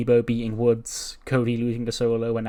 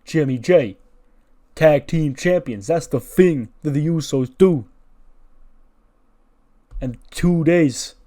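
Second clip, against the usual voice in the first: raised, emphatic hype delivery; wrestling tag-team promo; 4 semitones higher, 6 dB louder, slower than usual. Acted. Usos hyping their return before the match, loud and declamatory. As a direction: punch each phrase like a wrestler's promo.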